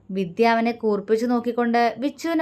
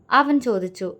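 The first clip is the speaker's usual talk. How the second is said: ordinary delivery, nothing marked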